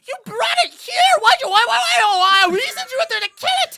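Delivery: a terrified little imp screeching at its own guards; panicked imp. Acted. Game master voicing a frightened imp to the disguised party, shrill panic. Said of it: "you hear the imp go".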